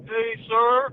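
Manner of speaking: put-on kid. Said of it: "this kid walks up to me".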